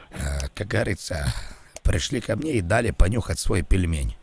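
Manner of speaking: put-on low, halting older official on the phone; polite low-voiced bureaucrat; elderly college official. Plays elderly college official on prank call.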